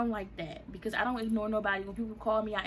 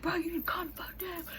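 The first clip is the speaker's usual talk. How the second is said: imitated screaming; 9 semitones higher than usual. He screams at her to calm down, high mock yell. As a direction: act out his screaming at held-back volume. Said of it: "we screaming at each other".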